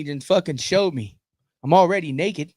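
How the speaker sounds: ordinary talk, nothing marked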